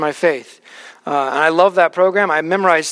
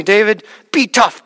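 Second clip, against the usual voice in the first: put-on firm Russian-accented encouragement to a crying boy; Russian accent, firm and direct; thick Russian accent; 3 semitones higher than usual. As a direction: say it like a big Russian man telling a kid to toughen up. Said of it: "with that thick Russian accent".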